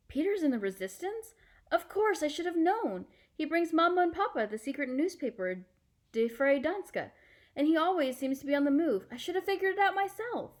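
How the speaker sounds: put-on a girl piecing it together; startled girl. Anne-Marie realising Peter's secret, high and amazed.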